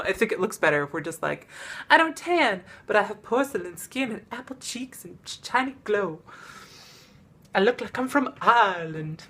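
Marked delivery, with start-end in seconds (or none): 1.9-9.2 put-on playful Southern belle accent. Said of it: "She said in a southern accent".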